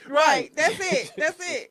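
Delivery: loud, punchy agreeing; emphatic agreement. Real, not acted. Backing up friend's point, loud emphatic agreeing. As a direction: shout agreement.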